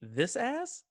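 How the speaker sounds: high, questioning line reading; hammy rising question; bad acting. Shown as a demonstration. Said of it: "put my acting face on".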